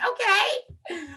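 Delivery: put-on high little kid voice; imitated pupil. Pupil agrees to get back to work, high mock child voice. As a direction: chirp it high like an obedient child.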